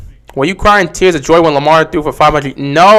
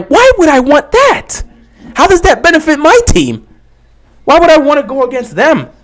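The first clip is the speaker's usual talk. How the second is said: much higher, disbelieving questions; incredulous; 10 semitones higher than usual. Real, not acted. Ravens fan asking chat why he'd want that, incredulous. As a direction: ask it like the idea is absurd.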